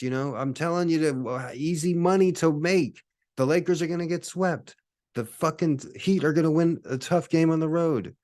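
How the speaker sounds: high, pleading huckster pitch; desperate time traveler. Pretend. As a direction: sell it like a frantic street tipster.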